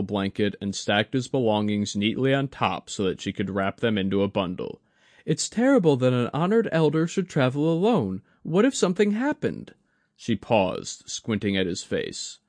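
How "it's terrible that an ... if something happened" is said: put-on higher, bright-eyed young shrine keeper, concerned; young woman's voice, worried and kind; Mai, young woman. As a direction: play Mai fussing over an elder.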